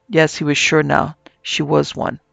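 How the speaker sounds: plain reading voice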